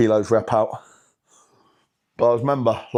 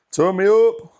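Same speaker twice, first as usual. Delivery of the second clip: pumped, loud and higher; hyped up; 9 semitones higher, 4 dB louder, slower than usual. Real, not acted. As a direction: psych yourself up. Hyping self before set.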